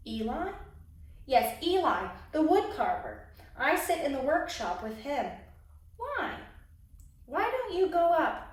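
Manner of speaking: lively storybook narration; expressive picture-book reading; reading to children. A narrator's reading. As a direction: read it like a children's story time. Reads a picture book aloud.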